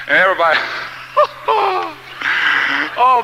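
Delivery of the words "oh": laughing. Real, not acted. Laughing while telling.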